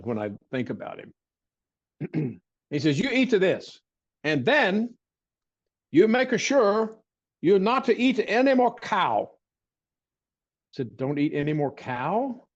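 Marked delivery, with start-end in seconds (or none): 3.0-7.0 higher, slow fond imitation of an old healer; silly voice. Recalling the barefoot doctor at his bedside, in a higher silly voice. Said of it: "the silly voice I'm doing". 7.4-9.3 higher fond imitation of the old healer; silly voice. The doctor orders the boy off beef, in a higher silly voice. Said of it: "the silly voice I'm doing".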